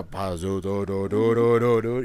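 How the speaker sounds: slow, booming nonsense syllables in a villain's manner; imitating sea witch. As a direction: mimic a villain's incantation, stretched out. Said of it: "especially when she's like".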